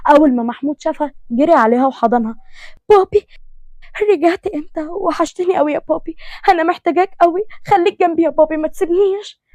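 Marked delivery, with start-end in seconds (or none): 2.9-9.4 put-on higher, tearful girl begging her father; a hurt girl clinging to her dad; pleading daughter. (بنت تترجى أبوها بصوت عالي باكي)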